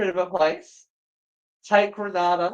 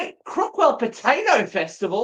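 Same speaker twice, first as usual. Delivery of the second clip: laughing, higher, wait Crookwell Potato Festival; surprised laughing outburst; 5 semitones higher than usual. Real, not acted. Friend hears of the potato festival, laughing surprise. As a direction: blurt it out in amused disbelief.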